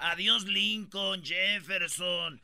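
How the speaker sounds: drawn-out slow goodbye; slow mock farewell. Mocking Trump's claim, slow drawn-out goodbye. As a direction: draw out each name like a farewell.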